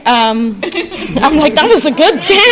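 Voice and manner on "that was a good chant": laughing. Real, not acted. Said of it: "I feel hype".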